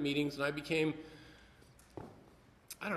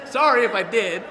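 much louder, laughing sorry; laughing apology; 4 semitones higher, 10 dB louder than usual. Real, not acted. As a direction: blurt the apology out through a laugh. Laughs after awkward remark.

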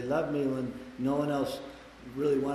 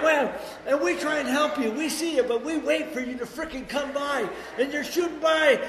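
usual speaking voice

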